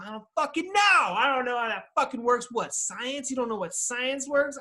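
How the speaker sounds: high, loud, fed-up cry; exasperated outburst. Real, not acted. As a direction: throw your hands up, loudly.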